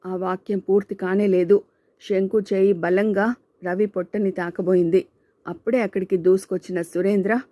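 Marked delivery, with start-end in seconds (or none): none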